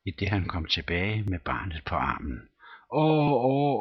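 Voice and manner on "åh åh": put-on high wailing child's cries. A narrator's reading. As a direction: wail like a scared little boy. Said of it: "skreg den lille stakkel igen". (barnligt jammer)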